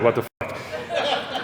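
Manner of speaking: soft, slow mock bafflement; muttered disbelief. Walking into work to Joe's antics, slow muttered disbelief. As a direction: let it slip out under your breath.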